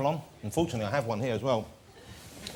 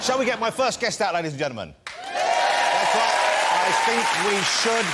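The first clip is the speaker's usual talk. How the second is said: announcer build-up; 6 semitones higher, 5 dB louder than usual. Acted. Host announcing first guest.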